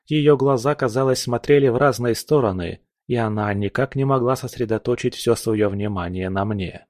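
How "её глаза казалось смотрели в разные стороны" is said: plain reading voice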